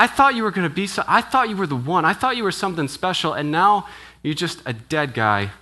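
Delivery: high, pleading lament of a let-down follower; disappointed disciple. Pretend. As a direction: plead as the disciple whose hope collapsed. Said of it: "you're thinking".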